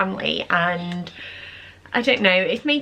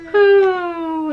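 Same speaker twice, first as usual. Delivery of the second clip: pigeon coo; 9 semitones higher, 5 dB louder than usual. Retold or imitated. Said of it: "an example there".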